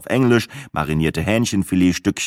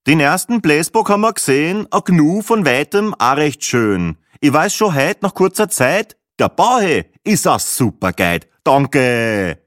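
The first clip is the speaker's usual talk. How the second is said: raised, sing-song verse recital; Viennese rhymester reciting; 6 semitones higher, 4 dB louder than usual. Acted. Rhymester praises the guide in verse, reciting proudly. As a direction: declaim the rhyme, settling onto a closing thanks.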